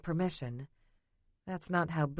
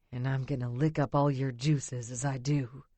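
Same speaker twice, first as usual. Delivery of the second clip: lower, lightly voiced man; low seductive male voice; male lover voice; 5 semitones lower than usual. A narrator's reading.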